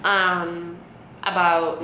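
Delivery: ordinary delivery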